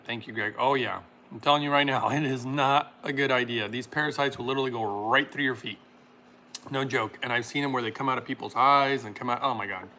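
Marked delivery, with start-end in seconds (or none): none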